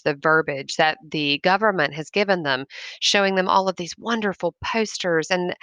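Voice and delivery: ordinary delivery, nothing marked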